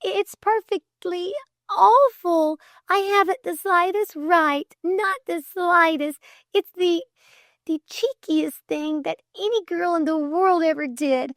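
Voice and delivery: high, distraught confession with faltering restarts; high-pitched halting wail of remorse; tearful struggle. A narrator's reading. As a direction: pour out an apology on the edge of tears. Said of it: "Great tears welled suddenly".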